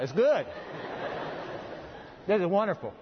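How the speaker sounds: talking while eating bread; words round a bite of bread; mouth full. Real, not acted. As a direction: talk through a mouthful. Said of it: "Don't talk with your mouth full".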